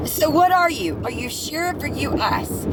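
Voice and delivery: ordinary talk, nothing marked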